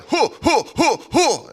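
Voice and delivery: imitated high, loud crowd hoots; punchy "hoo" whoops, mimicking onlookers; crowd hooting. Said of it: "a bunch of people in the corner going".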